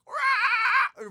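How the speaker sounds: high, loud screeched "rah"; creature's rip roar. Acted. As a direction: shriek like an attacking creature. Acting out creature ripping clothes off.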